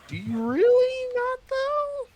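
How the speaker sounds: high-pitched, drawn-out disbelieving question; mock incredulity. Replying to people who claim not to care, mock incredulous. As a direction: squeak it out slowly in mock disbelief. Acted doubting reply to detractors.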